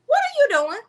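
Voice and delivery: fast, high-pitched angry tirade; angry outburst. Retold or imitated. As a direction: snap at him fast, pitch way up. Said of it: "I'm like".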